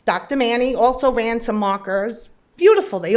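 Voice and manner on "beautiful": high, loud burst of relief; excited. Real, not acted. Patient cheering her good blood markers, high and loud. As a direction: sing out the good news.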